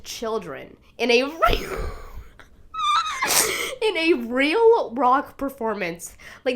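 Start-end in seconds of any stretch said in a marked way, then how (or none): 2.7-3.8 very high, cracking laugh-cry; overwhelmed laughing squeal; teary shriek laugh. Real, not acted. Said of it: "I'm literally tearing up".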